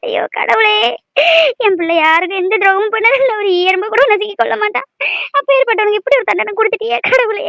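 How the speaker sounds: tearful lament with sobs; crying. Acted. Mother pleading with God for her son's life, crying. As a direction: cry out to God through tears. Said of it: "அழாதம்மா".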